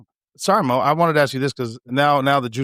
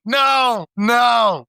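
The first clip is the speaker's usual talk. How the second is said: shocked shout; 10 semitones higher, 3 dB louder than usual. Real, not acted. Reacting to gross story.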